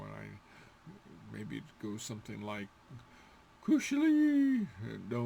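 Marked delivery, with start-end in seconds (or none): 3.6-4.6 Kushli sung high and falling; a high whale cry on Kushli; high-pitched try. Shown as a demonstration. Teacher trying a high whale call, then rejecting it. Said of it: "wouldn't be high pitched".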